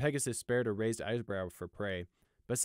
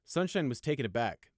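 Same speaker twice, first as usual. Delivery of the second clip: ordinary delivery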